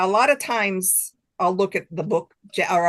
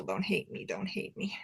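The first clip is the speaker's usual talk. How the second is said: much softer, pleading under her breath; sheepish plea; 13 dB quieter than usual. Real, not acted. Guest bracing to confess a dislike, hushed plea. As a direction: beg forgiveness softly before the confession. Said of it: "I'm so afraid to say it".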